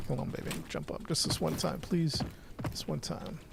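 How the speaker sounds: hushed pleading to the screen; soft coaxing. Real, not acted. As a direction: coax it gently, barely above a murmur. Coaxing his character to jump.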